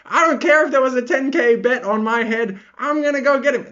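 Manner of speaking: high-pitched ranting impression; badly faked worked-up pundit rant; MMA Guru. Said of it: "in his best M M A guru impression ever".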